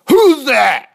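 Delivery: loud, high demand from an army; shouted challenge. Acted. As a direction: bellow it across the battlefield. Startled army calls out.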